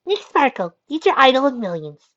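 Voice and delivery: very high, loud starlet impression; Marilyn Monroe. A narrator's reading. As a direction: announce yourself like a screen idol. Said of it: "in her best Marilyn Monroe accent".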